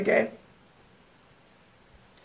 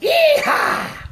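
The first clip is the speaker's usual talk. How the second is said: drawn-out cowboy yell, pitched way up. Retold or imitated. Preacher retelling how the Spirit answered him, cowboy whoop. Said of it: "like a cowboy".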